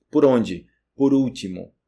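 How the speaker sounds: normal talking voice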